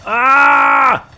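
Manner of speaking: high, full shout to the troops; battle alarm. Pretend. Commander to troops under attack, shouted alarm. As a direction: yell the alarm and order the charge. Ambushed mid-chat, calls the charge.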